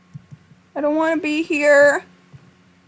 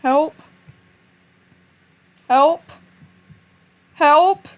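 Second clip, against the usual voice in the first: calling for help; 8 dB louder, slower than usual. Real, not acted.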